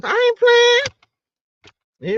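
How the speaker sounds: high, slow drawn-out i ain't playing; sassy insistence. Real, not acted. Telling viewers she is serious, slow and high.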